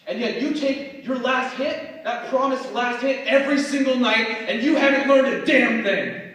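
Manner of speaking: forceful spoken word surging to loud peaks; impassioned slam poetry. Acted. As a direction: perform it like an urgent, accusing speech. Recites original slam poem.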